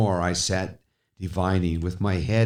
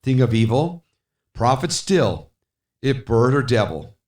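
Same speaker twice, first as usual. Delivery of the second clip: higher, louder pleading; fervent address; 6 semitones higher, 5 dB louder than usual. Acted. Speaker confronts the raven, raised and urgent. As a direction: press the bird hard.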